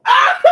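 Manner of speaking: high, loud shriek. Real, not acted. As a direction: let out a piercing yell. Drunk man screaming at the neighbours.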